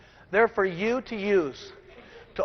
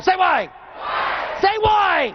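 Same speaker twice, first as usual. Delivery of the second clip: shouted call; 9 semitones higher, slower than usual. Acted. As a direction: demand the crowd answer you. Crowd call-and-response, pushing harder.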